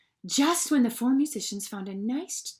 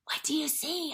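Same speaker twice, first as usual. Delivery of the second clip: hushed cat; 7 semitones higher, 5 dB quieter than usual. A narrator's reading. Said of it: "asked the cat".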